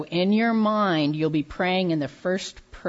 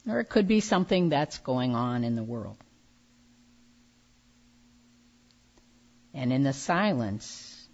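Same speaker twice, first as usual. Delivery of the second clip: calm grounding exercise. Real, not acted. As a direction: lead them gently into stillness. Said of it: "let go of distractions".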